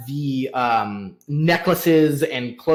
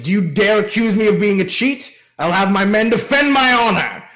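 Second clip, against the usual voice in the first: raised pitch, loud and rushed outrage; indignant threat; 6 semitones higher, 5 dB louder, faster than usual. Acted. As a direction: puff up and defend your honor. Merchant threatens his accuser.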